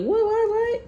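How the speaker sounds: high, loud squeal of disbelief; shocked what. Retold or imitated. Shocked reaction voiced.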